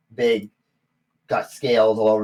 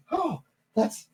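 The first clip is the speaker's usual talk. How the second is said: very high, halting placation; acted rattled little server; flustered gnome; 6 semitones higher than usual. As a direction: squeak out a stumbling, obliging reply. Gnome thrown down, placates the customer.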